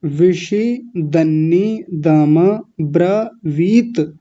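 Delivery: विषि दन्नी दम ब्रवीत slow, a gap between pieces; broken in four parts. Shown as a demonstration. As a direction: break विषि. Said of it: "चार जगह तोड़ लीजिए".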